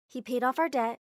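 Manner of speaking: ordinary delivery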